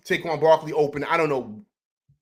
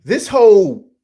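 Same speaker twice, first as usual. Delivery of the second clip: sudden loud, higher-pitched burst; outraged outburst; 5 semitones higher, 12 dB louder, slower than usual. Real, not acted. Fan erupting over the refs' calls, outraged. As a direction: explode on it, much louder.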